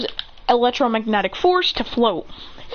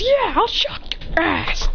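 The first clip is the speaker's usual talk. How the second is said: high, drawn-out comeback; acted threat; 11 semitones higher, slower than usual. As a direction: threaten the rival slowly, pitched up.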